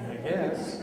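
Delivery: chuckling through the tag line; a little laugh at his own joke; amused teasing; laughing cheekily. Real, not acted.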